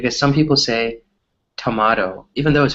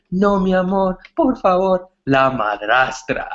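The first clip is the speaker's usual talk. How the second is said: higher, pleading telenovela heroine, hammed up; mock melodrama; 7 semitones higher than usual. Imitating a crying telenovela girl, higher and hammed up. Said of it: "the girl is crying".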